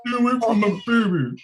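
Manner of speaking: high-pitched mock protest; Eric voice. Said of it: "That was Eric's voice".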